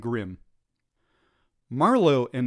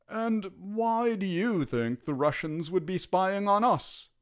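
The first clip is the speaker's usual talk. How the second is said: higher, doubtful lieutenant; a questioning officer, pitched up; skeptical officer; 6 semitones higher than usual. Acted.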